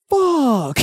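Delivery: high dismayed curse; caught drunk. Retold or imitated. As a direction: realize the cops are here. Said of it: "they're like".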